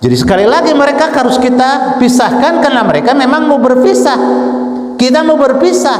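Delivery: high-pitched, insistent preaching; khotbah berapi-api. Real, not acted. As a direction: drive the point home in a high, sustained cry. (ceramah menggebu dengan nada tinggi)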